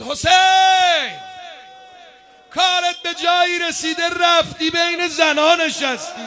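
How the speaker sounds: high, long-held yell of the name, then a high taunt; taunting shout. Acted. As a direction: call out across a battlefield as the enemy. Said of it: "فریاد زد".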